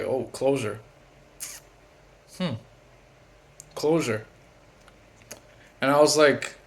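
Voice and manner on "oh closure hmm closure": soft, sarcastic repeat of closure; quiet mock disbelief; skeptical. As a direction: murmur it back, unconvinced. Skeptical reply to ex's excuse.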